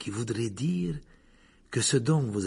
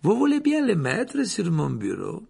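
ordinary delivery

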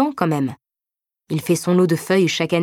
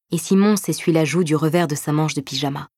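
usual speaking voice

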